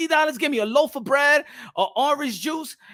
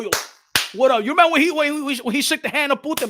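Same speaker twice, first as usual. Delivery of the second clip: usual speaking voice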